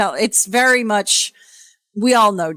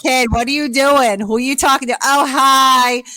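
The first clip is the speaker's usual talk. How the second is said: John; 4 semitones higher than usual. Retold or imitated. Brother barges in on the couple's video call, boisterous. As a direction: playfully imitate a boisterous pal butting in. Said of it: "who walks in but fucking John".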